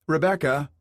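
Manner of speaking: husband calling his wife over. A narrator's reading. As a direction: call her name from across the room. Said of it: "Burkhardt called out".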